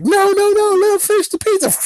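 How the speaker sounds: very high, loud squeaky plea; high-pitched loud no no no; mock pleading. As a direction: beg in a shrill cartoon squeal. Pleading for the turtle's pizza.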